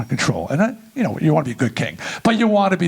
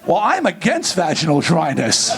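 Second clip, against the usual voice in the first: ordinary talk, nothing marked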